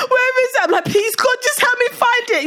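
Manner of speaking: high tearful begging; desperate pleading. Retold or imitated. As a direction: beg God through tears. Child begging God, crying.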